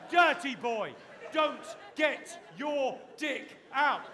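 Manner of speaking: yelling like scolding a naughty dog, very high and loud; hammed-up furious telling-off, dragging out the words; over-the-top scolding shout; mock scolding shout.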